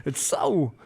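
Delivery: ordinary talk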